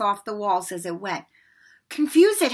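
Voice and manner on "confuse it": urgent, higher and louder; a fairly desperate order. A narrator's reading. Boy to his friend as the troll advances, desperate. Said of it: "Harry said desperately to Ron".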